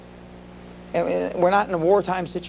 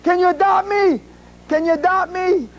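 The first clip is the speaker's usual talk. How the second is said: high, louder mock-kid plea; imitated kids yelling; 12 semitones higher, 4 dB louder than usual. Student begging the rich visitor to adopt him, high mock yell. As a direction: call it out like an eager schoolkid. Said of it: "all these kids started yelling".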